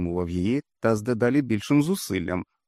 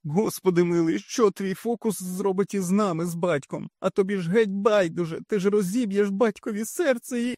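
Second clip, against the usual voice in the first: high, shrill-edged reproach; angry mother; 11 semitones higher than usual. A narrator's reading. Mother scolding Frannie, high and reproachful. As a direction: scold her like a wounded mother.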